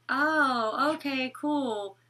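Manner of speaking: unimpressed, slow flat okay; flat indifferent reply. Retold or imitated. Olivia hearing she has no cancer, slow indifferent shrug. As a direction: shrug it off like no big deal. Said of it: "She's like".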